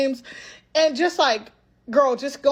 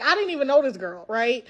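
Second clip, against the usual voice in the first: ordinary delivery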